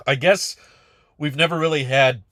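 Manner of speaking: choking on something. Real, not acted. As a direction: talk through a tickle in the throat. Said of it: "sorry, I'm choking on something".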